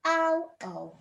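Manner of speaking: ow then O, each vowel drawn out clearly; modelling the two sounds. Shown as a demonstration. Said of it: "Say it".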